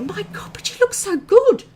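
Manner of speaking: high, loud gushing compliment. Retold or imitated. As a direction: gush over her, squealing. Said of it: "And I'm like".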